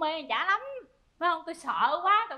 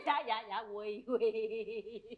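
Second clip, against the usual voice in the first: low, soft, repeated assent; meek submission; 7 semitones lower, 7 dB quieter, much slower than usual. Acted. Wife yielding to her husband, meek. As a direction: give in at once, small and low. Wife gives in, meekly kneels.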